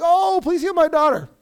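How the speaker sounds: loud, high mock plea; imitating a desperate plea; begging. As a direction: plead loudly for help, pitched high. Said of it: "she keeps begging".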